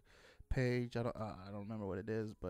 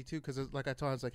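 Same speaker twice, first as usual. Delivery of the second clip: ordinary talk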